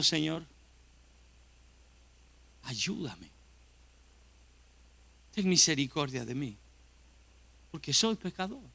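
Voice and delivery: hushed, humble plea to the Lord; soft, imploring whisper-prayer; quiet pleading prayer. Pretend. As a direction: pray it softly, as a sinner asking for mercy. Pretend sinner's prayer to God.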